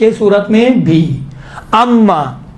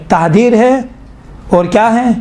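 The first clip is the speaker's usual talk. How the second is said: ordinary talk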